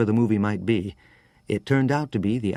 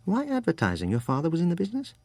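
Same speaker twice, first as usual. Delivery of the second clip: rapid, high English-tinged question, rising at the end; quick-fire query from a California Brit; slightly English accent; 7 semitones higher, 3 dB quieter, faster than usual. Acted. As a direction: ask fast and high, lightly English-accented. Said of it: "His accent was hard to place, something English in it".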